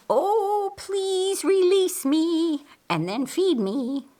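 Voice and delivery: high, slow hen's plea; a squawky chicken song, pitched high and drawn out; clucking chicken chorus. A narrator's reading. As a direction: cluck it out like a begging hen. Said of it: "by a chorus of clucking chickens".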